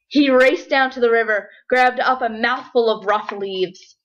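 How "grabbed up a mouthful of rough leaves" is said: ordinary delivery, nothing marked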